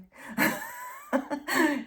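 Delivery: sudden high laugh bursting out; a real laugh escaping mid-thought; burst of laughter. Real, not acted. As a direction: laugh out loud, high and free. Laughs at her own remark.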